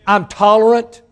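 tolerant shouted high and loud; shouted refrain. Real, not acted. Preacher shouting tolerant at the congregation. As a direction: burst out on tolerant.

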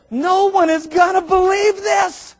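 astonished father exclaiming; amazed. Retold or imitated. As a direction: let the wonder burst out.

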